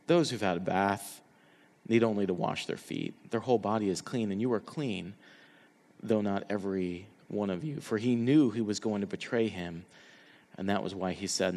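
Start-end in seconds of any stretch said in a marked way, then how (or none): 0.1-7.8 gentle Jesus. Acted. Jesus calmly answering eager Peter, low and soft. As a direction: lower and much softer, calm and reassuring.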